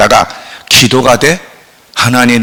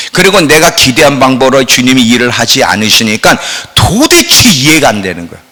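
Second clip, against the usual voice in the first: ordinary talk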